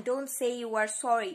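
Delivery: loud, forceful cut-off; angry. A narrator's reading. Thomas stops Alistair apologising, angry. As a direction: refuse the apology hotly.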